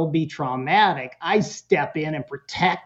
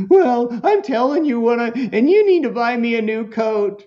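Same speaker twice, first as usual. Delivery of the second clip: put-on higher, louder, pushy kid; demanding kid voice; 6 semitones higher, 4 dB louder than usual. Son demanding that mom buy him a new coat, high pushy kid voice. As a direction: pitch it up and demand like a spoiled son.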